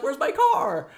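high-pitched exclaimed question; mock dismay. Character picked up by bus asks after the car, high mock dismay. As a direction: act out alarmed disbelief, high. Car owner shocked, acted quote.

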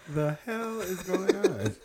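high, words squeezed out through laughter; helpless laughter. Real, not acted. Host baffled by complete randomness, laughing helplessly. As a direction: talk while cracking up, slowing down.

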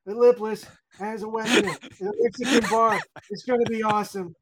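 high-pitched mock groggy mumbling; mumbled gibberish. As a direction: run the words together in an excited, high mumble. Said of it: "mumbling gibberish".

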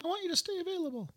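high squeaky quoted voice; mock controlling husband. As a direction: quote himself in a silly high voice. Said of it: "Sounds like me".

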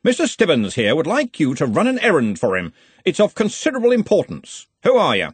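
a head of college sizing up a stranger; brisk questioning from on high; lightly voiced senior wizard, brisk.

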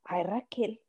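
hushed low whisper; mom's whispered jab. Retold or imitated. Mom whispering to daughter that she looks ugly. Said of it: "my mom's like".

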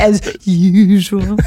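loud, drawn-out teasing echo; mimicked stumble. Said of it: "Why did you stutter over that?".

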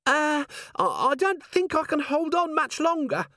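very high, strained squeal; panicked dwarf. A narrator's reading. Dwarf dangling from the candle line, high panicked voice. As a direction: squeak it out, barely holding on. Dwarf hanging over molten tallow.

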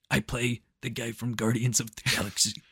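lightly mocking celebrity impression; Dave Bautista. Joining a friend's impression of the actor, put-on voice. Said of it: "doing your Dave Bautista voice".

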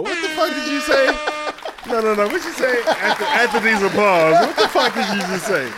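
hammed-up indignation at his cohost; mock outrage. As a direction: act scandalised at the joke.

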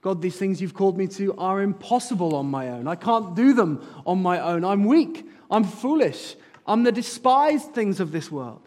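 loud, high, pleading confession; rising, fervent prayer voice; impassioned plea. Acted. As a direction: cry it out to God. Preacher voices humble prayer.

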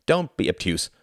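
a touch of irritation; snapped. A narrator's reading. Klaus snaps at Tom's comparison, mildly irritated. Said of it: "snapped Tom".

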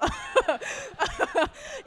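loud high laugh breaking out; laughing at her own refusal; high burst of laughter. Real, not acted. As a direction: laugh hard at yourself.

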